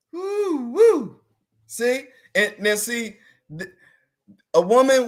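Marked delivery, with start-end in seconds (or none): 0.1-1.2 high hype cheer, getting louder; excited woo. Real, not acted. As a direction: whoop it up to hype the group. Hyping up the room.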